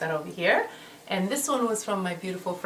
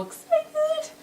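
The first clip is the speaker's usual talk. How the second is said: excited squeal. Real, not acted. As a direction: squeal it, pitched way up and slow. Opening a gift palette.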